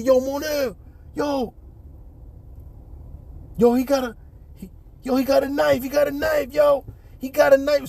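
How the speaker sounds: high urgent mock shout; alarmed warning. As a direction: warn your friend about the knife. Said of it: "so he said".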